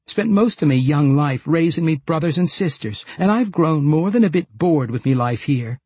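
brisk, plucky retort in a rustic dialect; spirited Deli. Acted. Deli talking Wulfgar into taking her along, spirited.

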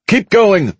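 high, urgent shout; Veronica shouting. A narrator's reading. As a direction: urge them on with a high-pitched yell. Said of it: "Veronica shouted".

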